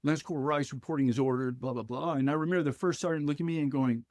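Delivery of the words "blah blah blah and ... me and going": normal talking voice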